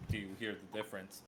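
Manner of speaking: distant, quiet, hurried; far from the mic. Shown as a demonstration.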